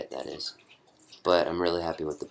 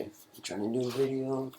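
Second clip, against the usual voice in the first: pleading with pet; 7 semitones higher, 4 dB quieter than usual. Real, not acted. Pleads with interrupting dog.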